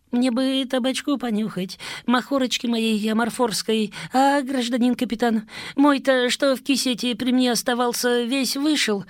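high, whiny begging; whining. Acted. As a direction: plead like a desperate addict. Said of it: "конючил Гришаня".

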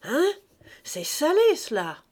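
a gloating reader relishing the scandal; triumphant. Acted. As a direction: savour the punchline. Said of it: "déclara d'un ton triomphant".

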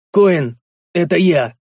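slow, loud call; shouting up. A narrator's reading. Hero hails dwarf chief on the wall, shouting. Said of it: "крикнул я".

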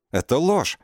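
high, loud indignant shriek; furious shriek. Acted. Sasha shrieks back at the absurd accusation. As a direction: shriek it in outrage. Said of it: "взвизгнула разгневанная".

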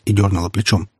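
plain reading voice

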